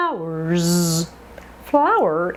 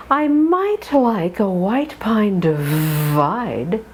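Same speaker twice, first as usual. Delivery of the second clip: the sentence read slowly, each diphthong drawn; slow it down and glide every I; slow drill reading; slower than usual. Shown as a demonstration. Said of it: "Sentence two".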